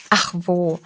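a sobbed, dismissive ach wo; a hint of a sob from a girl; sobbing. A narrator's reading. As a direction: choke it out through tears. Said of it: "schluchzte sie".

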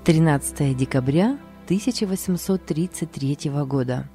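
plain reading voice

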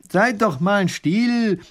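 high, loud, drawn-out call; calling for quiet. A narrator's reading. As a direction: call over a noisy crowd to hush them. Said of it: "versucht der Großherzog, sie zur Vernunft zu bringen".

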